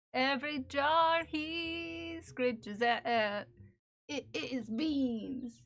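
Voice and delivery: slow, hushed mock-epic storytelling; slow mock-ominous voiceover; mock dramatic narration. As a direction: narrate the cat's doom like a trailer. Slow dramatic narration about cat.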